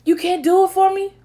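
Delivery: high, pleading rebuke; Jesus confronting friends. Retold or imitated. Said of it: "he confronted them again".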